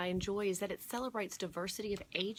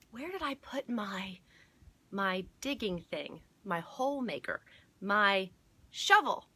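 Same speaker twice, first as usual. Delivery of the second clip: put-on searching old man; 3 semitones higher, 5 dB louder than usual.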